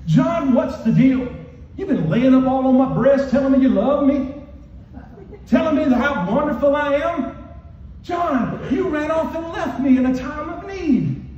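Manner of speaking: loud, high, aggrieved reproach; mock scolding yell. Jesus imagined rebuking John for running off, scolding. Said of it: "scream and yell at them".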